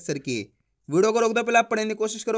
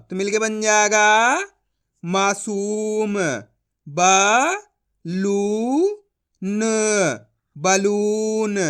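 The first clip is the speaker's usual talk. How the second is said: slow, loud syllable-by-syllable reading; syllable drill; 4 dB louder, much slower than usual. Shown as a demonstration. Teacher to students reading Hindi words, slow syllable drill. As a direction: sound out each syllable then join the word.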